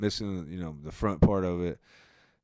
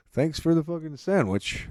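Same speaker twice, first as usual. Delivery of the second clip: ordinary talk, nothing marked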